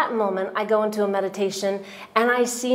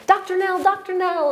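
put-on high, bright child's call, pitched way up; children calling; 10 semitones higher than usual. Children crowding round the teacher, calling her name.

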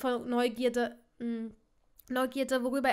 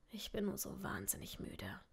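very soft, low, breathy weariness; almost whisper it, worn out; exhausted, hushed admission; tired murmur; 4 semitones lower, 11 dB quieter than usual. Acted. Exhausted father admits he is tired.

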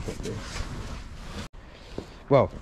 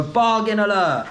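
Essex catchphrase, pitched up; Essex accent; 7 semitones higher than usual. Shown as a demonstration. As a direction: call it out in Essex style. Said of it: "to learn Essex accent".

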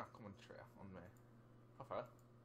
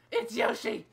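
loud, high outburst; excited shout; 11 semitones higher, 20 dB louder than usual. Real, not acted. Player spotting Yoshi mid-song, excited yell. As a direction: blurt it out in excitement.

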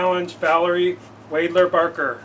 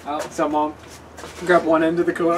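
ordinary talk

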